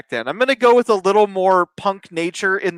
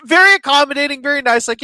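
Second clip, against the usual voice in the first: ordinary talk, nothing marked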